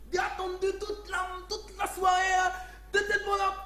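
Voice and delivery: put-on high, loud caricatured Chinese accent; mock Chinese accent. Indian restaurant man insults his customer, mock Chinese accent. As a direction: imitate an angry caller in fake Chinese accent. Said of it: "je fais un accent chinois".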